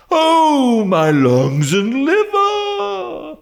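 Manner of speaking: very high, loud, slow anguished howl; crying out. A narrator's reading. Old dealer howling at the price, wailing. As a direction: wail as if the price wounds him. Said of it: "cried the old man".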